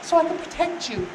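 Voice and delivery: high mock-feminine sweet talk; coy flirt. Delilah coaxing Samson to confide, coy and high. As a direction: plead sweetly, pitched well up. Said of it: "batting her eyes like we all know".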